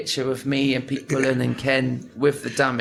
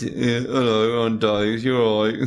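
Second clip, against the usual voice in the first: slow, lightly voiced dopey horse; a hint of a dim-witted horse; 4 dB louder than usual. Shown as a demonstration. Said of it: "I got Dave the horse".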